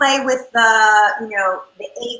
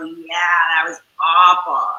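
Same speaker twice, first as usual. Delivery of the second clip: put-on glum kid; 7 semitones lower, slower than usual. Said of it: "Or some kids go".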